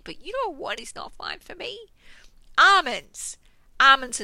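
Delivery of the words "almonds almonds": dramatic loud reveal. Acted. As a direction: punch out almonds, loud.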